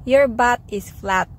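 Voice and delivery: normal talking voice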